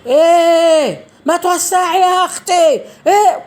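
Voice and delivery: mock shout, high and loud; retold angry shout. Passenger telling her to move over, mock angry shout. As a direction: imitate a man snapping loudly, pitch shooting up. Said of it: "وصوت بقى إيه، وصوت إيه".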